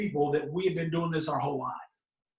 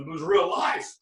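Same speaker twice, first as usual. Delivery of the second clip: normal talking voice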